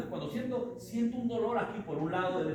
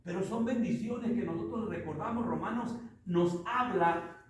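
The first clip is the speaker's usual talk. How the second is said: ordinary talk, nothing marked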